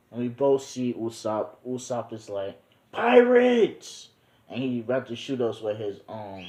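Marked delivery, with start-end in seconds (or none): 2.9-4.1 high startled yell of pirates; sudden high shout; shouted alarm. Retold or imitated. Usopp spots pirates, shouts.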